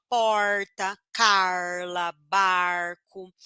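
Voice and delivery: Paraná retroflex R in porta, Carla, barco; interior Paraná accent. Shown as a demonstration. Teacher showing how her own region talks, Paraná accent. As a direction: curl the R as in inland Paraná. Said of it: "nós aqui do interior do Paraná".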